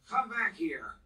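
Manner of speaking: put-on drunken slurred shout. A narrator's reading. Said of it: "He shouted in a slurred voice".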